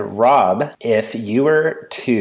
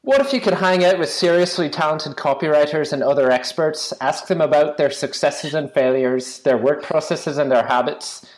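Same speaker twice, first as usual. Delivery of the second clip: higher, bright put-on British accent; 6 semitones higher than usual. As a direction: read the intro in a British accent. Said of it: "so I'm gonna do it in a British accent".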